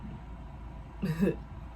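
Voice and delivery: imitating his nervous little chuckle; a soft breathy nervous laugh. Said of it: "do a little chuckle".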